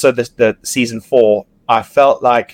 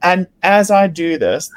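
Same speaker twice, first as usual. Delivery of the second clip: ordinary delivery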